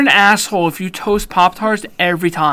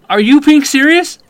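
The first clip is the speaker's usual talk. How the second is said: loud, higher, hammed-up grilling; mock interrogation; 5 semitones higher, 8 dB louder than usual. Host loudly grills Corey about how often he toasts them. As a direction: demand answers like a mock cop.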